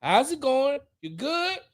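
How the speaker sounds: high, chummy greeting voice; mock greeting. People greeting Mister Swift, high friendly mock voice. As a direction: play the fawning well-wisher. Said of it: "They'd be like".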